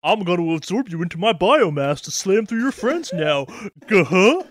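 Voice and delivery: goofy put-on cartoon voice with a chuckle at the end; silly monster threat, playfully hammed up; cartoon flesh monster. Acting cartoon character turned flesh monster.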